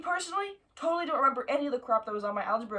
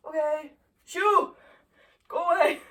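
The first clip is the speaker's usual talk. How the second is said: high, loud shoo at a bug; 7 semitones higher, 6 dB louder, slower than usual. Real, not acted. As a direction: shout the bug away. Shooing the bug.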